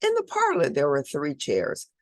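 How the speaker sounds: ordinary delivery, nothing marked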